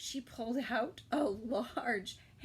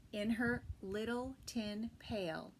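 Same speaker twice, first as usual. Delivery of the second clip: usual speaking voice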